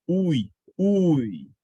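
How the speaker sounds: ui said on its own, the u strong and the i gliding off it; isolated diphthong demo. Shown as a demonstration. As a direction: ui pulled out of gratuito as a demo. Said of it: "Qual é o som mais forte?".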